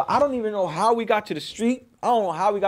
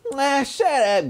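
sister scoffing; 7 semitones higher, much slower than usual. Retold or imitated. Sister dismissing him.

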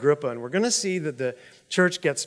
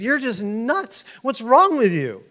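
acted indignant challenge, pitched up; insulted; 6 semitones higher, 5 dB louder than usual. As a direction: demand an answer as if personally offended. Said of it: "He's insulted".

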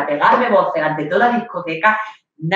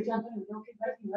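much softer, fast; soft, hasty goodbye; hurried hush; 3 semitones higher, 19 dB quieter, much faster than usual. Retold or imitated. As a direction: rush it quietly so her mother won't hear. Hurried goodbye so her mother won't hear.

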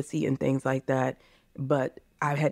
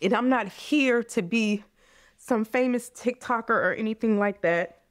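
still emotional; 5 semitones higher than usual. Real, not acted. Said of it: "I'm getting emotional".

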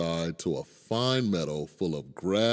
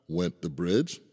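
plain reading voice

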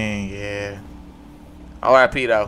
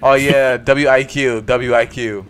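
usual speaking voice

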